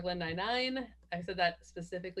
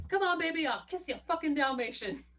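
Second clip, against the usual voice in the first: quoting John McClane; 8 semitones higher, 4 dB louder than usual. Retold or imitated. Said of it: "he's like".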